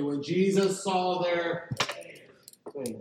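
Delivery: slow and deliberate, Jesus stretched out for the congregation to say along; a preacher pacing the line so everyone can join in; slow call to repeat together. Shown as a demonstration. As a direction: lead the room through it word by word, slowly. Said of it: "say that with me".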